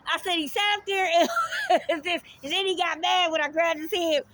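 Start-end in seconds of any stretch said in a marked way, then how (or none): 1.3-1.8 burst of laughter. Real, not acted. Laughs recounting grabbing his head.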